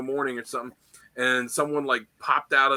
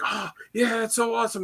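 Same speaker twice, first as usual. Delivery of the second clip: excited fan; 9 semitones higher than usual. Retold or imitated.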